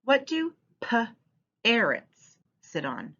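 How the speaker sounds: "p-arrots" said slowly as "p" then "arrots", the onset split off. Shown as a demonstration. Teacher splitting the onset of "p-arrots" for children, slowly. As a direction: break "p-arrots" into its first sound and the rest. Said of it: "read it like this".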